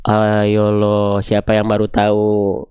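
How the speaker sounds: low, drawn-out teasing "gotcha". Pretend. Teasing students who just found out.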